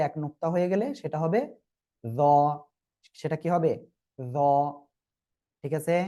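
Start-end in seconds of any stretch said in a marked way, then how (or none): 2.0-2.2 য with tongue tip on upper teeth; touch tongue to front teeth for য; dental য sound. Shown as a demonstration. Teacher names the new letter, dental buzzed য. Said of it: "উচ্চারণটা করবেন হলো". 4.2-4.4 য with tongue tip on upper teeth; touch tongue to front teeth for য; dental য sound. Shown as a demonstration. Teacher names the new letter, dental buzzed য. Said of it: "উচ্চারণটা করবেন হলো".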